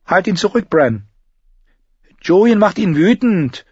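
high, loud urgent cry; urgent shout. Acted. Mira shouts at her brother to stop the wolf, urgent. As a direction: yell at him to hold the wolf back.

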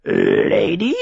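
low croaked question like a raven; croaking raven. A narrator's reading. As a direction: croak it out, bird-like. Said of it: "krächzte er".

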